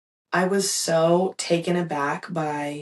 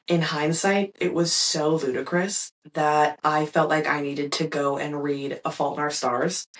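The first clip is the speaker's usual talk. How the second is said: ordinary delivery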